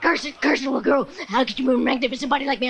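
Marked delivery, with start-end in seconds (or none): none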